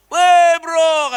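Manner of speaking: loud, high hail to a buddy; boisterous acted call. Older classmate hailing him in story.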